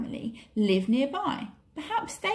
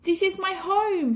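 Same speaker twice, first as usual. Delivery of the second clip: cling on and protest, pitched up; protesting boy; 9 semitones higher than usual. A narrator's reading. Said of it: "protested Mowgli".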